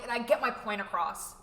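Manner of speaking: usual speaking voice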